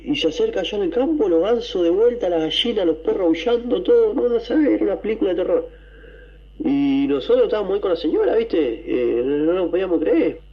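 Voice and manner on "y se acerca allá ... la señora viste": rising, hurried storytelling of the eerie scene; excited build-up. Retold or imitated. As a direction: pile on the dread as it all goes wild. Relato de miedo, sube la voz. (relato cada vez más agitado y agudo)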